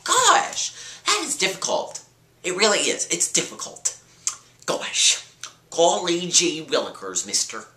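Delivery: put-on high, gee-whiz kid voice; wholesome 1950s kid. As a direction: play an innocent old-timey kid. Hokey exclamation, voice jumps high.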